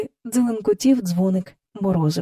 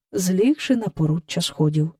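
usual speaking voice

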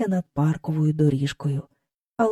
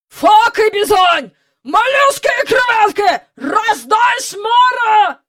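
high, loud sea-dog bellow; booming admiral; 13 semitones higher, 5 dB louder than usual. A narrator's reading. The admiral bellowing orders while afloat. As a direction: roar orders like an old admiral on deck. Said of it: "гримів адмірал".

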